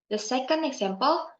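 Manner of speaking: ordinary talk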